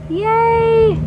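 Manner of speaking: high, loud drawn-out cheer; excited cheer. Real, not acted. Showing viewers the orchid in sheath, excited cheer. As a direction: let out a bright, high "yay".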